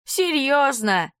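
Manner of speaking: slow, high, drawn-out mock disbelief; mocking echo. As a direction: stretch it out teasingly. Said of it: "озвучивает за меня мои мысли Вадим".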